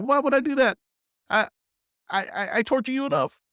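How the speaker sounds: playful high-pitched whine; squeaky high voice. Acted. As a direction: jump way up into a squeaky falsetto. Joking self-mockery about torturing viewers.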